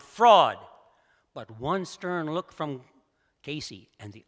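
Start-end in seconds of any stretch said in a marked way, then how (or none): 0.0-0.6 loud angry yell; echoing cry. A narrator's reading. The echo of the crowd repeating its cry, loud. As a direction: throw it back like the stadium echo. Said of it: "and the echo sounded".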